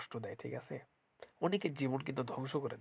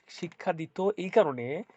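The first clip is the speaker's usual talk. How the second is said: ordinary delivery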